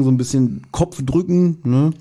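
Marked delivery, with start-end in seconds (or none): none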